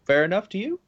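a lightly played huff of wounded pride; indignant inner retort. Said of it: "in my head, I'm like".

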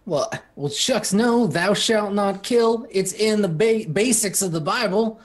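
put-on shucks Southern boy. GM voices Cameron, Southern.

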